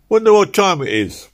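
Philpot's voice. Acted. Philpot interrupting the argument, pitched high. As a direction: call it out to change the subject.

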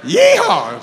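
high, loud yeehaw; mock cowboy whoop. Self-declared Texan joking with the congregation, high mock cowboy whoop. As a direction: let out a playful rodeo holler.

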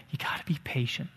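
whispered under the breath, no voice. Acted. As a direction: lean in and whisper it like a secret. Pastor urges patience in tense talks.

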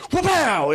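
a punchy, high "Wapow!" as the bird flips; mock wapow exclaim. [name] flipping the chicken, high punchy exclaim. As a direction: bark out "Wapow!" like a kitchen show chef.

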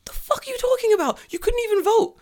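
very high, squeaky disbelief; incredulous retort. Retold or imitated. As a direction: protest in a strained high voice. Incredulous retort to the pub man.

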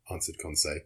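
usual speaking voice

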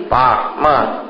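normal talking voice

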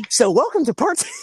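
high, loud laughing welcome; mock show intro. Guest mimicking a part two opening, high mock welcome. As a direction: pitch it way up like a chirpy intro.

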